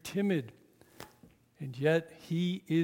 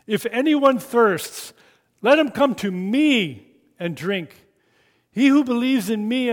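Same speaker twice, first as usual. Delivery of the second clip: slow, loud, raised call to the crowd; loud cry; 7 semitones higher, 10 dB louder than usual. A narrator's reading. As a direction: cry it out to the whole courtyard. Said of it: "he cries out in a loud voice".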